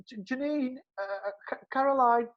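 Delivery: timid, high, faltering call; lightly voiced nervous lad calling out; super polite. New lad politely calling the girls at their door, high and hesitant. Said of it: "really super polite".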